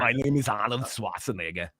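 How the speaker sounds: put-on Austrian accent; Austrian-accent movie-star impression; Arnold Schwarzenegger. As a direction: introduce yourself in a mock Austrian accent. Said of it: "in an Arnold Schwarzenegger voice".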